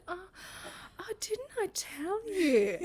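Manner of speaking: high, slow, fake innocent surprise; feigned surprise. Mother-in-law feigning surprise to the bride, drawn-out fake innocence. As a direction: imitate badly faked astonishment. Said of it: "she feigned this surprise".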